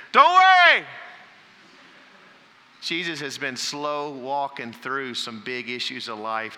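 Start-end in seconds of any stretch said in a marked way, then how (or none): 0.1-0.8 much higher, much louder yelp of don't worry; mock panicked yell. Shown as a demonstration. Pastor mimicking telling a panicked person, mock yelled. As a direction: shriek it like someone panicking you. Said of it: "the worst thing you could do is say".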